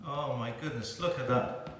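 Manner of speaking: almost under the breath, in awe; hushed, low marvelling at the cake; awed hush. Real, not acted. Gazing at the cake.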